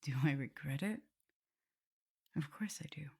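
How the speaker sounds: soft, ashamed whisper; regretful whisper. Acted. Vampire confesses hurting her mistress, ashamed whisper. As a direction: admit it under your breath.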